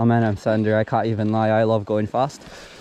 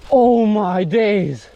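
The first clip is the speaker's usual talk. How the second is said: startled exclamation; 6 semitones higher, 4 dB louder, much slower than usual. Real, not acted. Near fall off trail.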